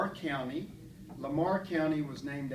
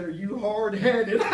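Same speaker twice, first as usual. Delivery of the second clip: loud, high country-character voice breaking toward laughter; hammed-up local storyteller, pitched up; Gene Rader; 5 semitones higher, 8 dB louder than usual. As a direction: imitate an eccentric Texan telling his punchline. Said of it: "He talked like this".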